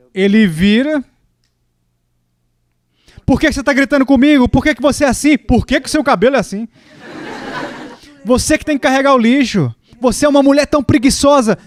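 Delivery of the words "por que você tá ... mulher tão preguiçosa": higher-pitched mock-angry husband's rant. Husband snapping back at his wife over the trash, mock-angry. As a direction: act out a husband's indignant tirade, pitched up. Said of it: "ele tá sentindo raiva".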